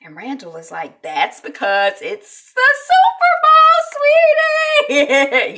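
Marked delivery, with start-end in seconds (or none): 1.0-5.6 loud, high, singsong glee; happy. Retold or imitated. Dad answers his daughter about the Super Bowl, happy singsong. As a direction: imitate an overjoyed dad, drawing it out. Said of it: "He's all happy".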